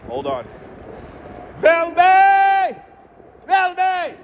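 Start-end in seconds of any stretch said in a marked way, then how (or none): 1.6-4.2 high, held shout of a donor's name; celebratory call-out. Pretend. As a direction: holler the name way up high, stretching the end. Hailing a super chat donor.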